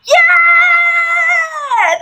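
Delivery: high, long, falling "yeah!"; whooping, drawn-out hooray; big cheer. Acted. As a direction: cheer loudly along with the crowd. Leads the big cheer requested.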